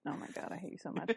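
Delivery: fast fed-up whisper; quick whispered jab at a friend; whispered exasperation. Real, not acted. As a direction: hiss it quickly under breath. Exasperated muttered aside.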